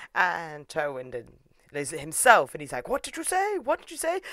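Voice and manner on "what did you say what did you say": high-pitched incredulous questioning; mock affronted disbelief. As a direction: challenge them in a high, play-acted voice. Acted affronted challenge.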